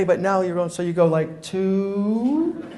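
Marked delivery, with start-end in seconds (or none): 1.4-2.7 mock dawdling. Stalling agent with slow digits.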